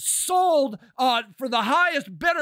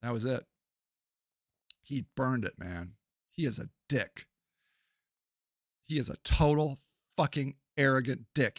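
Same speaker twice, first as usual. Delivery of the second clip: low, quiet, spent anger; quiet, bitter verdict after an outburst; subdued contempt; 11 semitones lower, 7 dB quieter than usual. Real, not acted. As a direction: sink low and soft, still disgusted.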